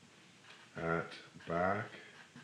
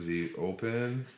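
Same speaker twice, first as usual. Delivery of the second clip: usual speaking voice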